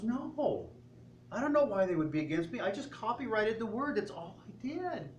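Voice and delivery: high, fast mock self-pity; poor me. Owner denying everything to the chef with a high poor me whine. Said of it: "Kind of the poor me thing".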